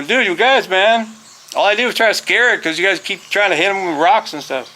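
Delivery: retold pleading with the kids; defensive protest. Retold self-defence to laughing kids.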